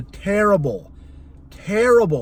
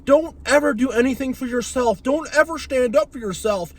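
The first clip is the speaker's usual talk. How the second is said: mocking the rules; 7 semitones higher than usual. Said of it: "we have this thing as a kid where it's like".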